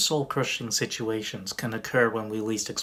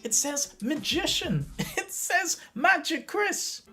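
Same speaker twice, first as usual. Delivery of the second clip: very high, squealing mock outrage; imitated outraged diners; 12 semitones higher, slower than usual. Spectators scolding the manager on the magician's behalf, imitated outrage. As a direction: mimic indignant customers in falsetto.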